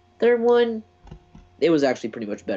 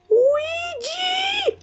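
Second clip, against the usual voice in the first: spooky shout. Pretend.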